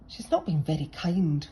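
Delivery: usual speaking voice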